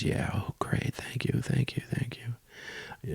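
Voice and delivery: near-whispered imitation of grateful relief; hushed happy thanks. Stranded woman accepting a ride on the boat, hushed mock gratitude. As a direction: mimic her delighted thanks under your breath, very softly. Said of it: "her face just lit up with happiness".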